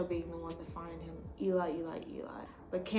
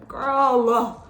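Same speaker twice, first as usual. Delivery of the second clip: loud, higher, slow telling-off; loud dismissal; 4 semitones higher, 8 dB louder, much slower than usual. Real, not acted. Fed up with Ashley, loud exasperated dismissal. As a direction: shout her off, exasperated.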